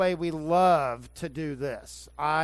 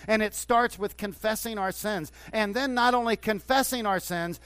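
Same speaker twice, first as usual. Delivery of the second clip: loud, high, full-force revival preaching; fiery Southern preaching; 6 semitones higher, 5 dB louder than usual. Acted. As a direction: preach it like an old Southern Baptist pastor. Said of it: "I'm sounding like an old Southern, Southern Baptist pastor".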